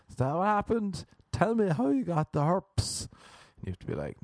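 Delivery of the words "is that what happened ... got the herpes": high, insistent questioning; mock interrogation. Prying into how someone got herpes, high mock interrogation. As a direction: pester them in a high, rising voice.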